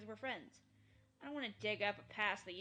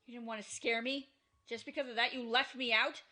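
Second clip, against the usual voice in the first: angry accusation; 10 dB louder than usual. Acted. Keiichi confronts Mion angrily.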